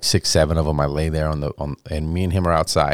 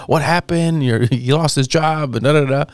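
alarmed friends; 8 semitones higher than usual. Retold or imitated. Friends calling mom, alarmed.

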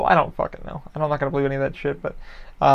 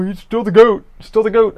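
louder, higher imitated fan insisting; loud fan friend; 6 semitones higher, 9 dB louder than usual. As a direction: bellow it like a die-hard Pats fan. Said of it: "my friend's like".

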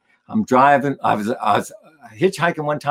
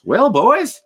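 hearty higher-pitched voice for the guy; retold music store guy; 8 semitones higher than usual. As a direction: play the guy, pitch swooping well up. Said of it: "And the guy goes".

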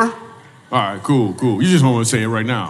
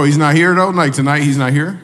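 ordinary talk, nothing marked

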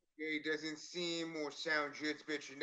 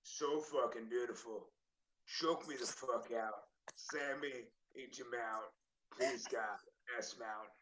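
lower, softer role-play read; sultry message reading; 4 semitones lower, 5 dB quieter than usual. Acted. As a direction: read the racy message low and soft. Retake of explicit message, read low.

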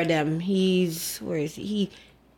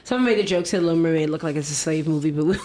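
ordinary talk

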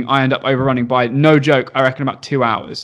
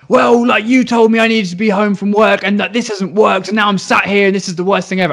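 hammed-up bickering complaint; mock argument; 6 semitones higher than usual. Dad snaps at his husband on the failed call, mock cross. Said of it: "properly arguing with each other".

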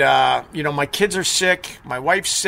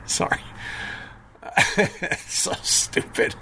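breathless whispered laughter; can barely speak through laughing; 2 semitones higher, 8 dB quieter than usual. Real, not acted. Laughing at chat message.

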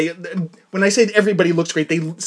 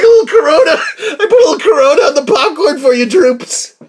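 high, loud, laughing mock server voice; mock popcorn server; 8 semitones higher, 6 dB louder than usual. Imagined popcorn kid offering germy popcorn, high laughing mock voice. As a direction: ham up a cheery server spreading germs. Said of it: "You're like".

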